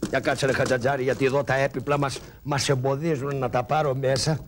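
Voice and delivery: low muttered excuse; low grumbling aside; low mumbling excuse. Acted. Karagiozis pretends furniture in way.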